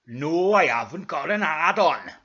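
slow, loud, exasperated denial; indignant character voice; Brian. Acted. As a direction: protest loudly, dragging the words out. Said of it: "said Brian".

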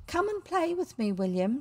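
high, eager calling up. A narrator's reading. Little girl calling up to William's window, eager. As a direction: call up brightly to a friend at the window. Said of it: "she called eagerly".

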